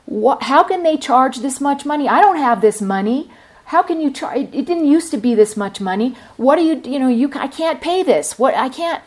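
high, loud, indignant ranting about the fines; angry loud complaint. Retold or imitated. Library patron yelling at the librarian about fines, angry. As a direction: imitate an outraged patron yelling at the desk. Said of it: "really loud in the library".

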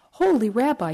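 lightly wailed plea; wailing. A narrator's reading. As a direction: cry out to the rabbi in mild despair. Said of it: "he wailed".

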